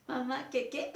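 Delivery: child acting while giggling; nervous giggle. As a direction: break into a nervous laugh.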